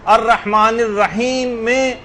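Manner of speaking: slow الرحمن الرحیم میں, the last م opened into a vowel "-me"; final zer voweled. Shown as a demonstration. Teacher shows joining to next verse, unfinished-sounding recitation. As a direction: carry الرحمن الرحیم میں on past the "m" with a short front vowel. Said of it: "اس کے نیچے زیر لگا دیا".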